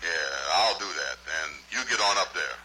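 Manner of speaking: deep comic character voice; low-pitched dialect character; held low voice. Acted. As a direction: hold the voice right down low. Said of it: "Now you hold that voice".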